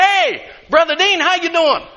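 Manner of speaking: high, bright mock hello; hammed-up hello; cheery church greeting.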